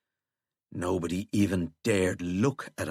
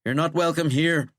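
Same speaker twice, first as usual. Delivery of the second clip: raised, firm, louder voice; angry rebuff; 7 semitones higher, 5 dB louder than usual. A narrator's reading. President rejecting Withering, angry. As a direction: push him away with the words.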